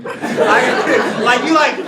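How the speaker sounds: ordinary delivery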